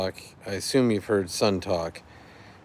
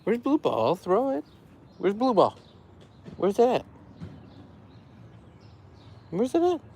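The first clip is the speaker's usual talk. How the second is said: pet talk. Real, not acted. Playing fetch, talking to pet.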